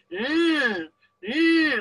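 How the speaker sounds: high nasal-free "eh" revving up and cutting off; imitated engine rev. Neighbor's yard tool mocked as a high revving hum. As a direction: mimic the neighbor's machine revving. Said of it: "they go like this".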